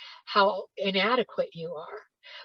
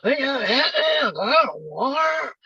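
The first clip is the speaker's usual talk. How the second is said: higher, louder but still slurred mumble; mumbling teenager; 6 semitones higher, 5 dB louder than usual. Retold or imitated. Teen asking a third time, louder slurred mumble. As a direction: mumble it more insistently. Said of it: "in this very low, slow way".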